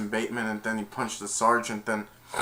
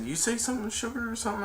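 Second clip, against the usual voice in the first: mock taunt; 12 semitones higher, 4 dB quieter, much faster than usual. Taunting in a fake voice.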